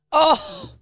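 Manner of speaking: very high mock laugh; imitated posh laugh. Mimicking how Louisa laughed, high hooting laugh. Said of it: "She laughed like this".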